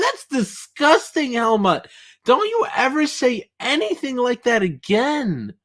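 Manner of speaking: high, slow hammed-up indignation; theatrical disgust, drawn out; mock scolding. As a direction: scold him like a prim aunt.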